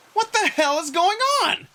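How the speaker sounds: high, loud incredulous outburst; baffled exclamation; exasperated bewilderment. Acted. Margot being rescued unwillingly, baffled outburst.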